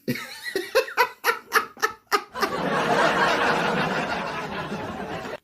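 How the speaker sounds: a long, high-pitched giggle that trails off. Real, not acted. Preacher giggling to her livestream viewers, high giggle. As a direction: giggle helplessly at your own boldness.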